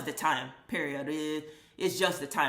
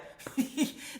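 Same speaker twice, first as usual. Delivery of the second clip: quiet unvoiced laugh; 9 semitones higher, 3 dB quieter than usual. Real, not acted. Laughing at own joke.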